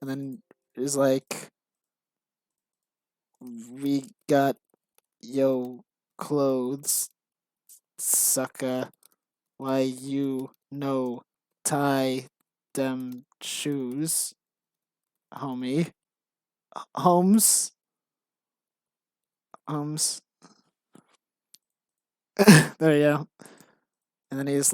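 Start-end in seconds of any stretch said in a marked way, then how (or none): 3.4-15.9 slow mock gangster, drawn-out words; hammed-up gangster, word by word. Writer reading out own embarrassing script, mock gangster. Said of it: "stereotype of how gangsters talk". 16.9-20.2 mock gangster slang, each word set apart. Writer trying out script slang, mock gangster. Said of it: "stereotype of how gangsters talk".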